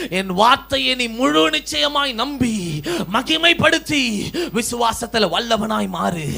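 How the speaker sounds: loud, rising preaching that builds; fiery preaching. Acted. Preacher to the church about Anna's prayer, loud rising fervour. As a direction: preach it at full force.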